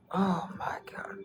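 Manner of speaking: hushed aside to the viewers; whisper. Real, not acted. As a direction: whisper it as if not to be overheard.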